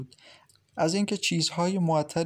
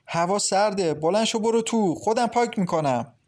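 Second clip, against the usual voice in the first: sharp, higher and louder scolding; angry; 6 semitones higher, 4 dB louder than usual. A narrator's reading. Mother-in-law scolds the nauseous Maryam, angry and loud. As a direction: scold her to go inside. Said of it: "ماهرخ با عصبانیت نگاهش کرد و گفت".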